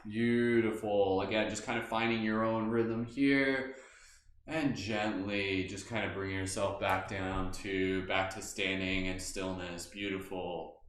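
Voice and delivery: slow, soft meditation voice; guided meditation. Acted. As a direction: guide them gently inward. Leads a meditation.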